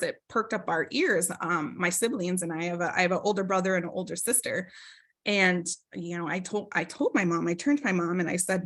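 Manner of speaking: ordinary delivery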